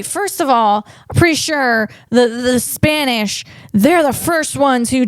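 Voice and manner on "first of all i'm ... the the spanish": ordinary delivery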